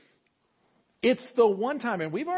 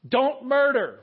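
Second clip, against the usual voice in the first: loud, higher booming command from the mountain; God yelling; 6 semitones higher, 8 dB louder, slower than usual. Acted. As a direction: thunder it down from the mountaintop. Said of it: "starts yelling at the people".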